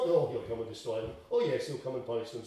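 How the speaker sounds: low, quick, offhand mock quote; dismissive understatement. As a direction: toss it off as no big deal. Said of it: "It's not ... sort of thing".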